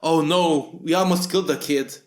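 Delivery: ordinary talk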